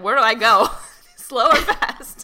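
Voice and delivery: loud laughing confusion. Retold or imitated. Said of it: "so I'm like".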